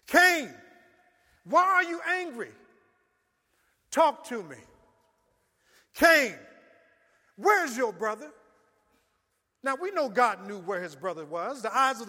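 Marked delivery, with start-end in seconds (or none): 0.1-8.3 loud, high calling voice; God calling Cain. Acted. God demands answers from Cain, loud and high.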